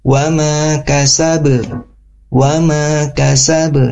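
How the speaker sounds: slow recital of "wa ma kasab", echo on the b; bouncing final b. Shown as a demonstration. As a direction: stretch "wa ma kasab" and bounce the last b. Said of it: "Sama pantulannya dengan di ayat yang pertama". ("wa ma kasab" pelan dengan pantulan di akhir)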